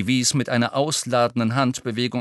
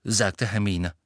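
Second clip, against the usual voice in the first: ordinary delivery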